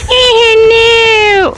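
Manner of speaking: high, long drawn-out 'no' wail; mock wail. Real, not acted. Player reacting to the game, mock wail.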